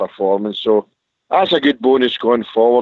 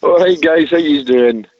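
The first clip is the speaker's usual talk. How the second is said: higher, louder posh greeting; posh phone voice; 5 semitones higher, 3 dB louder than usual. Acted. Caller greeting the radio hosts in his posh voice. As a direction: put on your best posh voice to greet the hosts. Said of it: "He sounds really posh on the phone".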